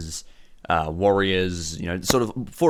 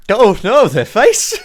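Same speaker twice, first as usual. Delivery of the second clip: loud high cry breaking into cackles; uncontrollable laughing; 11 dB louder, much slower than usual. Acted. Boss laughs at his men's faces, loud and high. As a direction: shriek it through helpless acted laughter. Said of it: "breaks down in uncontrollable laughing".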